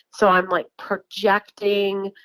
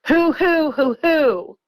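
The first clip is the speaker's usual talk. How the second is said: mock owl hoots; 6 semitones higher, slower than usual. Retelling the fake owl calls from the woods, hammed-up high hoots. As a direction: fake owl call, pitched way up and pushed out. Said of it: "the worst barred owl imitation you ever heard".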